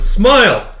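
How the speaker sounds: high, loud command; sharp order. Acted. Kidnapper ordering the slapped girl, harsh command.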